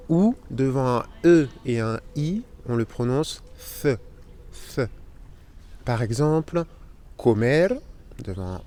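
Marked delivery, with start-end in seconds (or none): 3.8-4.0 "th" as a lisped English th; th with tongue between teeth. Shown as a demonstration. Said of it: "il suffit de mettre la langue entre les dents". 4.7-4.9 "th" as a lisped English th; th with tongue between teeth. Shown as a demonstration. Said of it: "il suffit de mettre la langue entre les dents".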